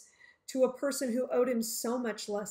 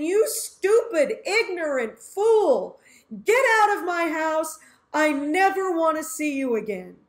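furious shout; 4 semitones higher, 8 dB louder than usual. Pretend. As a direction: roar at the friend in rage. Choking the friend, furious insults.

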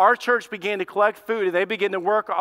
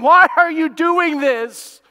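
tearful question; 8 semitones higher, 7 dB louder, slower than usual. Retold or imitated. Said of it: "they're crying".

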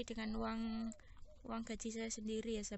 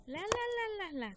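cat calling; 11 semitones higher, 7 dB louder than usual. Real, not acted. Calling cats with syllables.

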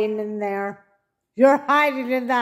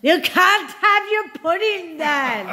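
playful scolding schoolteacher, pitched higher; mock stern teacher; 7 semitones higher, 3 dB louder than usual. Wife teasing husband over meat and pudding, mock stern teacher. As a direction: bark it like a strict teacher, half laughing.

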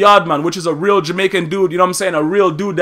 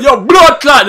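loud, high hammed-up Jamaican yell; over-the-top patois outburst, loud and high; mock Jamaican shout; 6 semitones higher, 6 dB louder, slower than usual. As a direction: bark it like a wannabe yard man. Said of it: "he just like".

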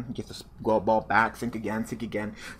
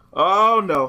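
dismayed cry; 8 semitones higher, 10 dB louder than usual. Real, not acted. Realising the duel is lost.

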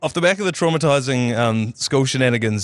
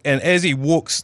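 ordinary delivery

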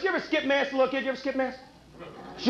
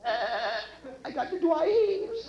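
Elmer Fudd; much slower than usual. Pretend. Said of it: "Elmer Fudd on New Year's Day".

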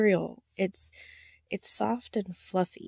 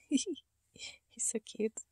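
whispered coo; 3 semitones higher, 10 dB quieter than usual. Real, not acted. Cooing over puppy.

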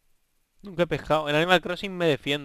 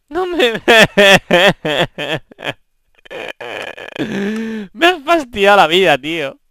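loud, high wail breaking up; exasperated outburst; 8 semitones higher, 14 dB louder than usual. Real, not acted. Gamer complaining companion ruined his life, loud exasperation. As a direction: burst out loudly, high and despairing.

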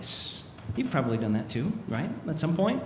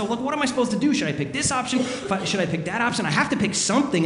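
higher, mildly frantic self-quote; freaking out; 6 semitones higher, faster than usual. Retold or imitated. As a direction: retell your own mild panic over a choice. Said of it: "freaking out exactly like I was freaking out".

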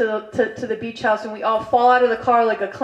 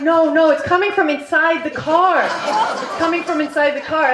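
loud, higher-pitched insisting; loud alarm; 5 semitones higher, 4 dB louder than usual. Retold or imitated. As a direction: raise your voice in retold panic. Retold alarm inside the car.

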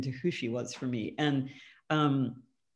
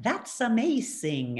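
slow "That's amazing", pitched higher, with "That's" stressed and drawn out; accented "That's amazing"; 6 semitones higher, slower than usual. Shown as a demonstration. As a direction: say "That's amazing" the way her mother's accent shapes it. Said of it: "I say it more like".

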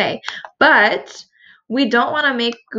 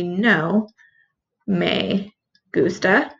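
"No me gusta" drawn out slowly with a rise on "gusta"; slow and careful; 2 semitones lower, much slower than usual. Shown as a demonstration. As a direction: model it slowly so the order sticks. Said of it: "Make sure that your no goes in the right spot".

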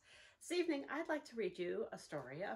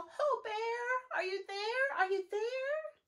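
high, louder searching calls into the woods; calling out; 13 semitones higher, 7 dB louder, much slower than usual. Acted. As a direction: call out across the forest to find him. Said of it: "They call".

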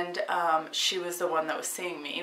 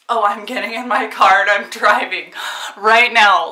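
louder, determined, half-shouting it out; retelling her defiant reply, much louder; defiant exclamation; 11 dB louder than usual. Retold or imitated. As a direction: insist you're driving yourself, no argument. Defiant retort to doctor's order.

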